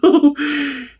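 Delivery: high falsetto giggle; little high-pitched housewife titter; housewife laugh. Acted. Neighbour titters to the interviewer in a high falsetto.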